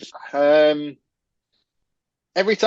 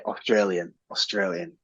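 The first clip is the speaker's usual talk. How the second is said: Australian accent attempt; 2 semitones lower, 6 dB quieter than usual. Shown as a demonstration. Host warming up for a requested accent, tentative Australian. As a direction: feel your way into an Australian accent. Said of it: "How do I do Australian".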